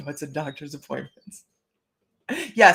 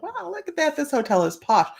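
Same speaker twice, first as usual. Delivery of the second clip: high, delighted mock-awe; impressed; 10 semitones higher than usual. Retelling her reaction to the posh hotel, high delighted gush. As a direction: gush over the fancy hotel. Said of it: "I'm like".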